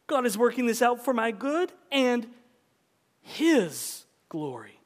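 His glory slowed right down; stress on His glory. Shown as a demonstration. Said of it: "emphasize different words in that sentence".

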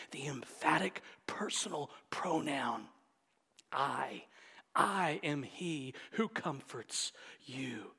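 low, near-whispered reverence; hushed awe. Acted. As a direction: drop right down as if marvelling at a discovery. Acting a student's awe at grammar.

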